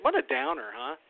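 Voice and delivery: rueful tease at his gloomy story; wry self-mockery. Real, not acted. Host to listeners, a wry high-pitched aside.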